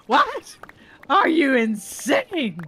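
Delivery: high, loud outburst, slowing on are you insane; a mom yelling at the teacher in disbelief; mock outrage. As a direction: play up the disbelief, loud and drawn out.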